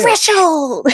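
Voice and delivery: high, loud call cutting in; playful outburst. Pretend. Co-host interrupting with a dreaded episode title, playful yell. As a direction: blurt it out over the other person.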